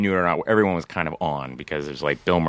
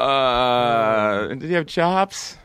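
drawn-out uh, then higher question; mock hesitant question; 4 semitones higher, much slower than usual. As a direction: fumble slowly into an awkward question. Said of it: "And you go".

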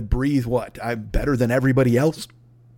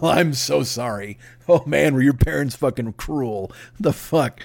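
usual speaking voice

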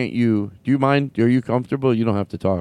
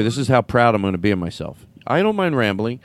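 ordinary talk, nothing marked

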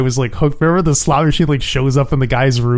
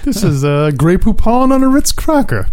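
posh butler; 6 semitones higher, 3 dB louder than usual. Acted. Butler impression.

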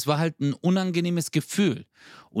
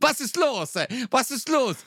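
imitating the sauna guy much higher, innocent and puzzled; high mock voice of the guy; 10 semitones higher than usual. Imitating the sauna stranger acting innocent, high-pitched mock voice. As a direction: play him dumb and innocent in a high voice. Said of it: "Dann sagt er zu mir so".

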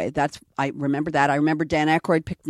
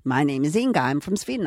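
put-on comic Swedish accent; 2 semitones higher than usual. Actress repeating her improvised Inga line, comic Swedish accent. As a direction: play a comic Swede, swooping up.

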